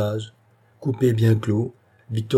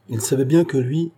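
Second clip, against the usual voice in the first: ordinary delivery, nothing marked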